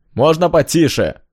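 high, slow, loud angry neighbour. Acted. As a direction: complain loudly through the wall. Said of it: "послышался злой голос из соседнего номера".

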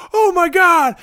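overjoyed can't-believe-it squeal; a kid thrilled his name was called; giddy disbelief. Retold or imitated. Freaking out with joy.

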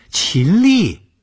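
high, loud startled cry; shocked. Acted. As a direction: gasp the name out in shock. Startled echo of dead girl's name.

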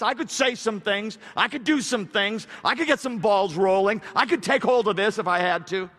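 loud, high, insistent run of short phrases; full-force preaching. Acted. Preacher voicing a believer resisting self-rescue, full-force preaching. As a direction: hammer out each phrase.

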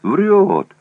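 high, sneering jeer; mocking. A narrator's reading. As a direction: scoff at the lie loudly. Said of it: "насмешливо проговорил Жорко".

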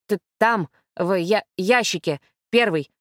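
plain reading voice

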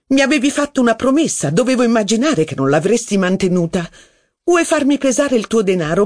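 high, mocking jeer; taunting Rachel. A narrator's reading. Rachel mocks Saint Loup's threat to leave, high and scornful. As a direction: needle him in a high scornful voice.

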